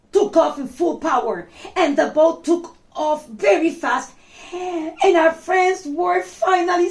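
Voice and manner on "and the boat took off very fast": ordinary delivery, nothing marked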